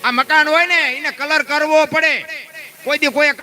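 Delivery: high, loud and emphatic, hammering each point home; preaching at full force. Acted. Preacher urging the crowd to work for a clean life, full-force preaching.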